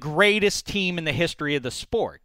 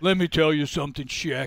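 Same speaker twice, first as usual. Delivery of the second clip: imitating co-host. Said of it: "me doing your voice".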